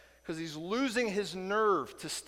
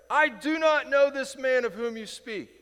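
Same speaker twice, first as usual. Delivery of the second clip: higher, louder sworn denial; vehement oath; 9 semitones higher, 7 dB louder than usual. Retold or imitated. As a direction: swear it out hard. Peter swearing his denial.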